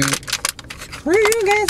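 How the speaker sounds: very high, stretched-out squeal; hammed-up sulky falsetto; mock cartoon whine. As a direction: whine it slowly in a silly high voice. Repeats curse in put-on voice.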